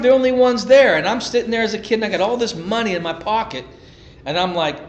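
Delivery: ordinary talk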